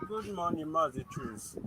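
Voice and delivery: faint distant phone voice; very faint, far from the mic; barely audible. Real, not acted. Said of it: "Your voice is very faint".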